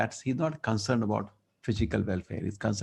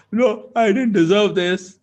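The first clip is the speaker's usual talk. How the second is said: much higher, louder, slow; a king who might have protested his fate; mock protest; 10 semitones higher, 8 dB louder, slower than usual. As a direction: whine a grievance. King's imagined protest.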